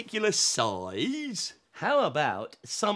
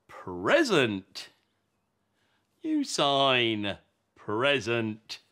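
slow demonstration; 9 semitones lower, slower than usual. Shown as a demonstration. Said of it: "show us the sign for present".

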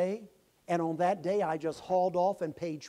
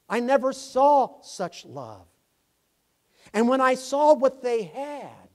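much louder, slow, rising in awe; swelling testimony; 8 semitones higher, 13 dB louder, slower than usual. Retold or imitated. Convert telling the church of neighbors' love, loud and slow. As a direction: build it to a cry of wonder.

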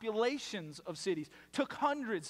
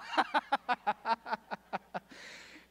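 long, rattling burst of laughter; hearty laugh; 3 semitones higher than usual. Real, not acted. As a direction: laugh it out at the crowd's confession. Laughing at audience member admitting it.

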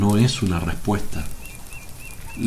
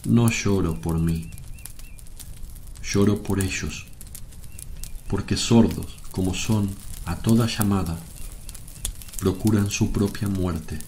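sorrowful. Acted. Jesus weeping for those deaf to his call, sorrowful. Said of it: "sobre la mesa y llora".

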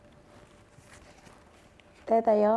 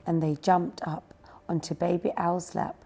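ordinary delivery, nothing marked

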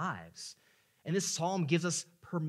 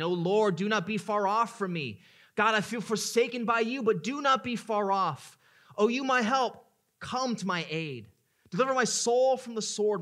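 loud desperate plea, much higher; pleading; 8 semitones higher, 9 dB louder than usual. Acted. Preacher acting David pleading with God, loud desperate cry. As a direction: beg God at full voice. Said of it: "David is pleading".

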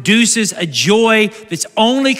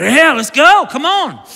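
hyped; 5 semitones higher, 5 dB louder, slower than usual. Retold or imitated. Acting excited after reading verses.

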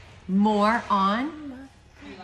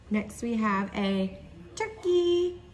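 ordinary talk

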